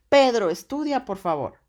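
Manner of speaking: loud, high angry shout at her son; angry mother. Acted. Mother catching Pedro on his phone, angry shout. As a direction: shout the order like a fed-up mom. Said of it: "Cuando llega la mamá".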